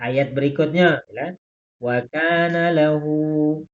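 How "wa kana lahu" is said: drawn-out, deliberate Quran reading; slow recitation. Shown as a demonstration. Teacher slowly reciting a verse to students. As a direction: read each syllable slowly for the class. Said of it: "seharusnya wak tahu".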